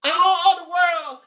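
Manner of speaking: ordinary talk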